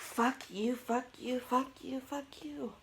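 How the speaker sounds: angry. Real, not acted. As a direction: chant the curse crossly at the sneeze, rising in pitch. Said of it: "I'm getting angry".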